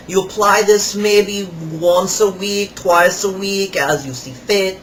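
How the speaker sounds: imitating the salesman. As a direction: ham up the pushy kiosk seller. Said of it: "which goes something like this".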